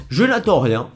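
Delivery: normal talking voice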